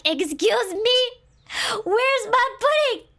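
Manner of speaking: high, outraged little-sister demand; mock-outraged, high and slow; indignant confrontation. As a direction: demand it loudly, drawing out the accusation.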